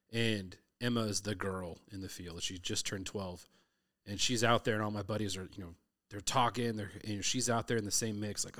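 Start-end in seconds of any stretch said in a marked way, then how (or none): none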